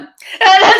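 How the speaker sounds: high loud laugh that breaks out; loud burst of laughter. Real, not acted. Bursts out laughing at own jab.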